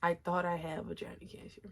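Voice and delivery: hushed confessional whisper; breathy secret whisper, no voice. Real, not acted. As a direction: whisper it like a secret you're ashamed of. Confessing an embarrassing scare.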